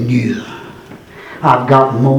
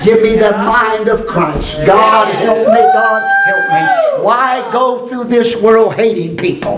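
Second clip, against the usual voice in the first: higher, pleading cry to God; preaching at full force; 7 semitones higher than usual. Acted. Preacher crying out to the church, full force preaching. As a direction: preach it hard, climbing.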